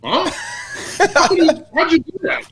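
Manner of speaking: high, amazed voice with a laugh in it; mock astonishment, squeaky high "huh?"; imitating dumbfounded teammate. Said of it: "And Diker was like this".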